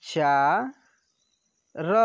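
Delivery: चा and र said apart with long pauses; syllable by syllable. Shown as a demonstration. Teacher spelling out syllables for pupils, slow and separate. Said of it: "तो व में से छोटी की मात्रा वि".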